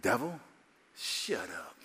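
low, soft dismissal of the devil; quiet rebuke. Retold or imitated. Preacher silencing the devil's doubts, low hushed rebuke. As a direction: shut him down under your breath. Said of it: "And I said".

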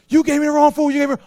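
high, loud mock angry complaint; angry customer. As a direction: customer yelling about the wrong order.

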